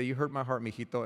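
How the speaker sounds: soft, low, sorrowful mother; a mother gently telling her son he hurt her; mom's hurt voice. Retold or imitated. Mother tells her little boy he hurt her, softly sad. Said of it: "is what she would say".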